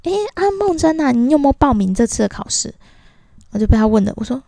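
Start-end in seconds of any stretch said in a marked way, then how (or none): 0.0-2.7 higher, lightly voiced old man calling out; a hint of a chatty old uncle; imitating old uncle. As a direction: hail her like a nosy old colleague. Old uncle calls out to her.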